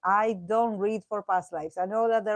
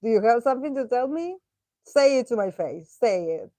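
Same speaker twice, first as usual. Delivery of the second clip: confrontational demand; 3 semitones higher, 6 dB louder than usual. Retold or imitated. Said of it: "They are like".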